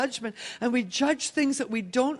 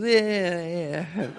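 put-on drunk slur; 7 semitones lower, slower than usual. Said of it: "He's so drunk he can't talk".

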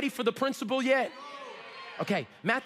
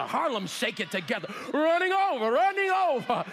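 hammed-up offering-time preacher; 2 semitones higher than usual. Preacher parodying offering-time appeals he has heard, hammed-up frenzy. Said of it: "most of the time when you hear this verse is at offering time".

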